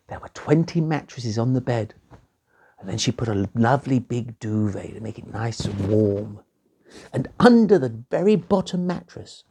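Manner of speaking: plain reading voice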